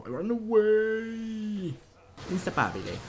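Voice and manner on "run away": drawn-out call. Acted.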